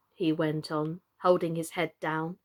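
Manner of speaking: ordinary delivery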